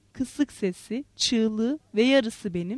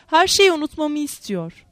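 usual speaking voice

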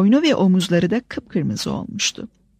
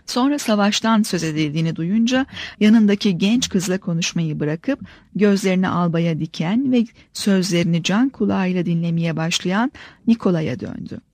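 usual speaking voice

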